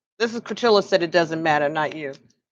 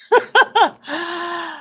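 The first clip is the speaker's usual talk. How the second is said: burst of laughter; 9 semitones higher than usual. Real, not acted. Amused at chat.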